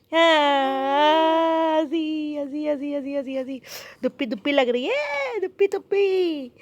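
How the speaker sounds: mother cooing at her infant in the sun. Real, not acted. Cooing to baby, applying oil.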